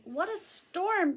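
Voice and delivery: lightly voiced high, slow wren; twittering wren. A narrator's reading. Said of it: "twitters wren".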